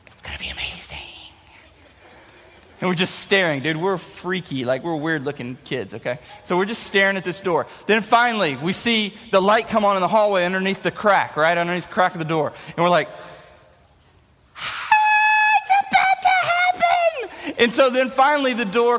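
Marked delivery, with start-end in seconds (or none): 0.1-0.8 breathy whispered promise; excited whisper. Retold or imitated. A kid whispering to his brother, excited whisper. Kids staring at the door. 14.6-17.3 excited shout. Retold or imitated. Two kids squealing as the hallway light comes on.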